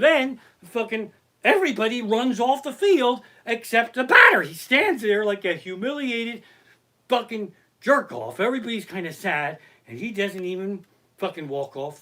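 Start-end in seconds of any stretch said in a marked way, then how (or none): none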